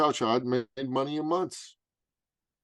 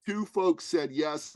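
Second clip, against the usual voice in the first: ordinary talk, nothing marked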